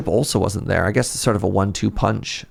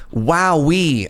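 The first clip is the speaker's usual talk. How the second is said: amazed audience; 6 semitones higher than usual. Pretend. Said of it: "I hear you say".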